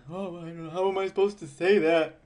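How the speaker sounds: mildly mock the nervous pushover; needy nice guy. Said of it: "the average nice guy dude is gonna be".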